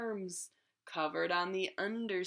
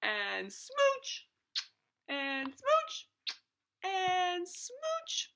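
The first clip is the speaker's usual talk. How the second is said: slow start, climbing much higher each time; each smooch louder and higher; building smooches; 10 semitones higher, 6 dB louder, much slower than usual. Acted. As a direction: rise with every smooch. Fish builds to kissing.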